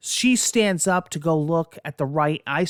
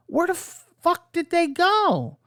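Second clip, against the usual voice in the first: much higher, slow, stretched-out disbelief; bewildered cry; 3 dB louder, slower than usual. Retold or imitated. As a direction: drag it out, utterly baffled. Family vanished from boat.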